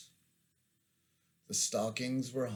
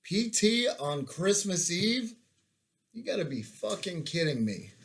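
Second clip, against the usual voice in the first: disbelieving yell; 10 semitones higher, 6 dB louder than usual. Retold or imitated. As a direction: howl it in disbelief from the bed. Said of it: "I could hear Thompson yell".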